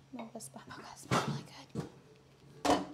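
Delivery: whisper. Real, not acted. Whispered aside while filming food.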